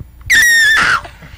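piercing high pterodactyl screech; pterodactyl shriek. Shown as a demonstration. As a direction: let out a loud shrieking squeal. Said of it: "Go for it".

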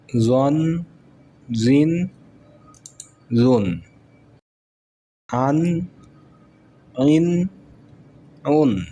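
rising-rising-falling chant of each syllable set; teacher's recitation drill. Shown as a demonstration. Quran teacher chanting tanween drill to students.

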